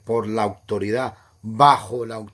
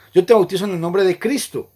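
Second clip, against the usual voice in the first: raised, louder solemn recitation; baptismal formula voice; 7 semitones higher, 8 dB louder than usual. Retold or imitated. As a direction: intone it like the officiant at the font. (fórmula bautismal, más alta y fuerte)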